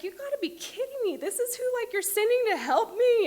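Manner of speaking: exaggerated 'you can't be serious'; incredulous disbelief. Disbelief at the helper at the door.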